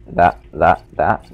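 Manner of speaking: ordinary talk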